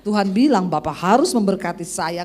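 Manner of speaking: slow, low mock prophecy; drawn-out, put-on prophetic pronouncement; fake prophet. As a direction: ham up a solemn word from God. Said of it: "Kan banyak kan yang begitu-begitu".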